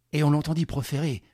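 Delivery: ordinary delivery, nothing marked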